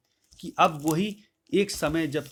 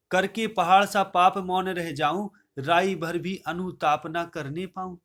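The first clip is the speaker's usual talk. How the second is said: raised, measured verse recital voice; poem recitation; 2 semitones higher, 4 dB louder than usual. Acted. Teacher reciting Kaikeyi's verse to the class, declaiming.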